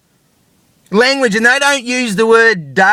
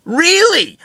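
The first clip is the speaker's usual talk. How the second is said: sarcastic disbelief; 8 semitones higher than usual. Real, not acted. Sarcastic retort in rant.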